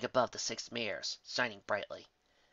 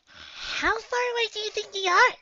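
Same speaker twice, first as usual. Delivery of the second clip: very high, squeaky cartoon pony; bubbly Pinkie voice; 7 dB louder than usual. Pinkie's voiced character line.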